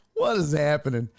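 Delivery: normal talking voice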